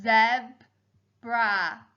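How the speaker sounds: "Zebra" as zeb, pause, ra; split into syllables. Shown as a demonstration. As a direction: break "Zebra" into two slow beats. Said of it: "The second syllable was".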